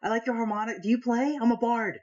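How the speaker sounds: high, chatty character voice; eager Twilight. Acted. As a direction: chirp up to a new acquaintance. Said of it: "I'm Twilight".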